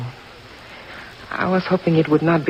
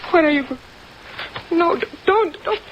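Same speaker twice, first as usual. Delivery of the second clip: frightened pleading; 13 semitones higher than usual. Acted. Pleading as he approaches.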